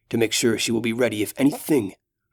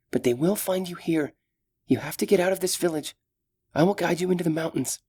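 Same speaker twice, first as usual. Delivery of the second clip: brave elf heroine, raised voice; gentle, caring young elf; young elf woman; 7 semitones higher than usual. Acted. Solana's voice held.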